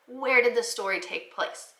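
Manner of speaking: normal talking voice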